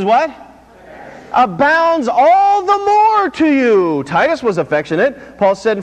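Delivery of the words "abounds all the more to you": ABOUNDS ALL THE MORE drawn out slowly, pitched high; slow, soaring scripture emphasis; emphatic verse reading. Real, not acted. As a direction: stretch the line out high and slow, preacher's emphasis. Emphatic finish of congregation's verse.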